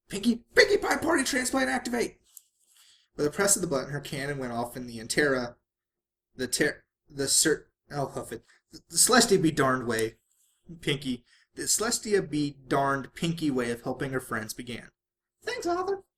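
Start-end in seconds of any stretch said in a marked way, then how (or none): 0.1-2.2 very high, loud gleeful command; excited battle cry. A narrator's reading. Pinkie fires her party cannon, high gleeful loud cry. 15.4-16.0 squeaky thanks. A narrator's reading. Pinkie voiced very high.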